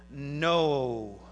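low, drawn-out "no"; key word announced. Shown as a demonstration. Preacher pointing out "no" to underline, deliberate. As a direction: stretch "no" out low and weighty.

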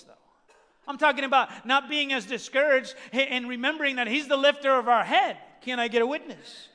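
loud, rising preacher's fervor; impassioned preaching. Real, not acted. Preacher urging church not to be hopeless, loud and impassioned. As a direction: proclaim it forcefully to the congregation.

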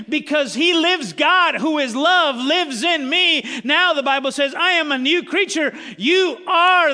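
loud, rising pulpit preaching; full-throated sermon delivery; fervent preaching. Acted. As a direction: preach it out to the whole room.

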